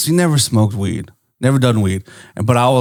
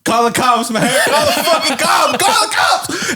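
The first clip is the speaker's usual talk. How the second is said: plea climbing to a very high shout; hammed-up frantic begging, higher each time; mock panic; 10 semitones higher than usual. Begging his friend to call police, escalating mock panic.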